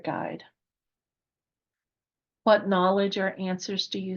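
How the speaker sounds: measured meditation voice with long pauses; guided meditation. Real, not acted. Guide leading listeners to meet their spirit guides, calm meditative delivery. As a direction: guide listeners gently inward, leaving space between phrases.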